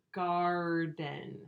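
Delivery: garden drawn out slowly on a level tone; sounds stretched out. Shown as a demonstration. Teacher stretching the sounds out for pupils, slowly. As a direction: stretch both halves of garden, "gaaar-den". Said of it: "Stretch the sounds out for me".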